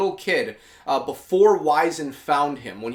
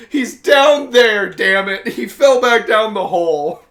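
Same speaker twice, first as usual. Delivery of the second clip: slow, much louder, high desperate yell; anguished shout; 5 semitones higher, 8 dB louder, slower than usual. Retold or imitated. Dom yelling to Marcus that Jace fell back down. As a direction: bellow it out, torn up that he's gone.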